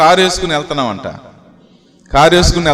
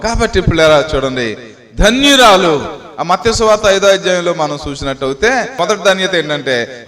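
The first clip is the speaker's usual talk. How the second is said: usual speaking voice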